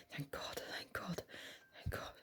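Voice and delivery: unvoiced, breathless whisper; hushed whisper. A narrator's reading. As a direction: whisper it in terrified relief. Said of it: "in a hushed whisper".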